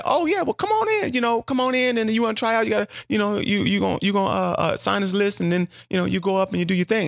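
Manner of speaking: high, eager mimicked door lady; high mocking imitation of a fussy door lady; high lady's voice. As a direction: rattle it off high as the welcoming lady. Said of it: "she said".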